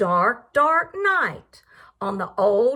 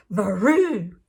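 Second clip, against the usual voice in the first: lower, lightly hammed-up cat hiss on Varoo; 7 semitones lower than usual. Voicing the woken cat, a mild mock hiss. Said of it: "who hissed".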